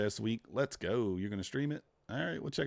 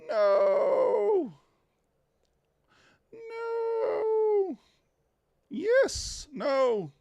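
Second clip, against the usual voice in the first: high yelled no's at the chart; sharp high cries of disbelief; real frustrated shouts; 5 dB louder, much slower than usual. Real, not acted. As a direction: yell at the screen as it ticks. Shouting at the price moving.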